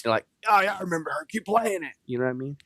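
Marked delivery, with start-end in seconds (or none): 0.5-1.9 high, put-on elderly voice; nursing-home resident. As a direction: imitate an old fan reminiscing, pitched way up. Imagined elderly residents reminisce.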